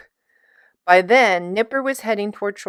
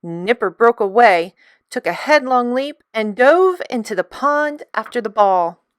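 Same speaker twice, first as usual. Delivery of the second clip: ordinary delivery, nothing marked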